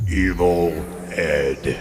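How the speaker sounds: deep, loud wrestling-announcer name call; booming announcer callout. Acted. Announcer booming the cohost's nickname, deep and loud. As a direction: boom the name like a ring announcer.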